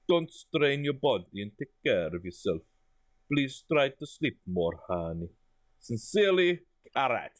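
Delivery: put-on letter sign-off in a comic Eastern European accent; put-on foreign accent. Boss telling Laura to rest, put-on foreign accent. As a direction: keep up the makeshift foreign accent. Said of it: "I've just given this accent".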